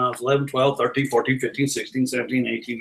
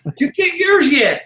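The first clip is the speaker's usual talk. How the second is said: barker hook; 10 semitones higher than usual. Retold or imitated. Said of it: "I'd say".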